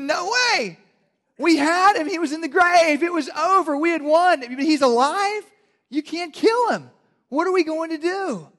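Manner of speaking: very high, loud whining cartoon panic; Satan wailing in high panic as Homer Simpson. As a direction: shriek it like a cartoon dad losing it. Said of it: "Satan quoted Homer Simpson".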